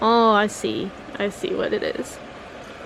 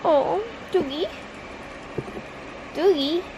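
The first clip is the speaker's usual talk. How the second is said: calling the cat; 6 semitones higher, 5 dB quieter than usual. Real, not acted.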